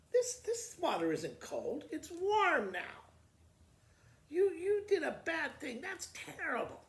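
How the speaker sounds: high, loud accusing sister; angry scolding. Acted. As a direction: scold her for bringing warm water. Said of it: "Rose touched the water and goes".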